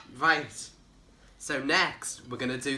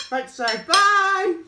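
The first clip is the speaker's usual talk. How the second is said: sing-song goodbye; 12 semitones higher, 8 dB louder, much slower than usual. Real, not acted.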